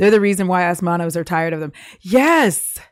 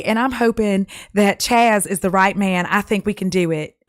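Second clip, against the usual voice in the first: put-on Southern accent; 2 semitones higher than usual. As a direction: play a cringy Southern dating-show contestant. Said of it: "like, a Southern accent".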